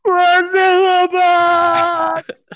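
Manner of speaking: loud, high, hugely drawn-out wail; film line impression. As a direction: stretch it into a hammed-up movie howl. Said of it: "then he'd done this, this, like".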